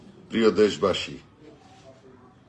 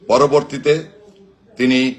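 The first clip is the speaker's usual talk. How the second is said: usual speaking voice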